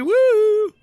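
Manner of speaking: high-pitched woo; excited whoop. Real, not acted. Commentator capping his Aussie joke, high whoop.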